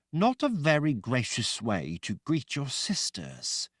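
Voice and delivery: a haughty sister's reproach; Sister Greta voice. A narrator's reading. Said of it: "Sister Greta said".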